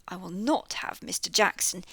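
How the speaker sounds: ordinary delivery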